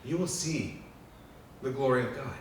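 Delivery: God tenderly promising; God reassuring. Retold or imitated. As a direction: coax them to hold on. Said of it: "God says".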